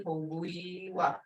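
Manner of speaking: hobuiwa. slow, with a gliding U-E in the middle; teacher models the diphthong slowly; slow diphthong demo. Shown as a demonstration. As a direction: stretch the ui glide in hobuiwa. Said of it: "Hear that?".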